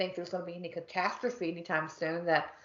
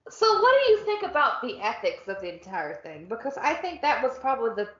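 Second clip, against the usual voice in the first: normal talking voice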